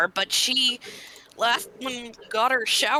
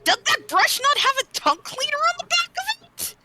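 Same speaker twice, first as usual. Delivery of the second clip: high, disbelieving question; incredulous; faster than usual. Real, not acted. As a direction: ask in pitched-up disbelief. Incredulous about brush.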